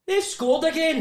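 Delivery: a man with a fish supper calling out that they've scored again; called-out news. Retold or imitated. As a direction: shout it to strangers as you pass. Passer-by calling out goal news.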